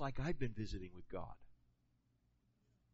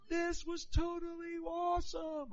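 put-on high, slow little-kid voice, amazed; a small boy on a tricycle, thrilled; 13 semitones higher, slower than usual. A little kid at the end of The Incredibles, high and slow with delight. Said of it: "And he goes".

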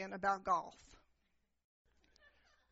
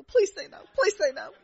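higher, louder mock pleading; desperate plea; 9 semitones higher, 8 dB louder than usual. Preacher begging the staff to refuse, hammed-up louder plea. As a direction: beg it like a kid hoping hard. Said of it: "in my mind, I'm thinking".

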